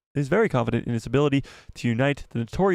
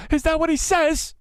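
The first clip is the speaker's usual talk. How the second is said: high squeal. Real, not acted. Same question repeated, squeaked high.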